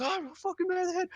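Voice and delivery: very high mock ranting; imitated freak-out. Friend mimicking the man insulted as a meth head, freaking out. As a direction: squeal it like someone losing it. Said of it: "This dude's freaking out".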